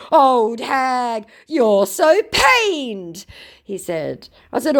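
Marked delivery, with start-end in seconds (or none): 0.1-3.3 loud, high, very drawn-out jeer; mock scammer outburst. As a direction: imitate a hammed-up riled scammer. Voices triggered scammer's insult.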